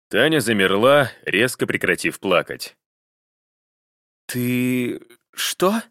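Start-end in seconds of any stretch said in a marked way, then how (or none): 4.3-5.8 high stunned woman's gasp; stunned, caught out; wife stunned her husband hired a detective; shocked wife. A narrator's reading.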